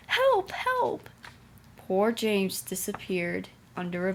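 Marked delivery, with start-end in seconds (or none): 0.1-1.1 frightened cry for help. A narrator's reading. James cries for help as trucks push him. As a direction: shriek it like a runaway engine. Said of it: "still whistling".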